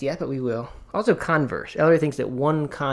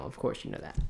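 whisper; 12 dB quieter than usual. Real, not acted. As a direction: breathe it almost silently. Aside to parent about child.

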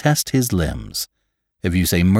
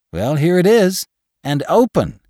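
high, louder exclamation; alarmed discovery; 10 semitones higher, 5 dB louder than usual. A narrator's reading. Gravedigger showing Jim the open coffin, alarmed. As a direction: announce the find with pitch shot up.

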